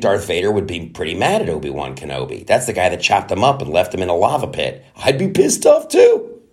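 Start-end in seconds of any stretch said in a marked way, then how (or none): 4.9-6.4 squeaked high and slow; mock outrage. Real, not acted. Agreeing with Vader, high indignant squeal.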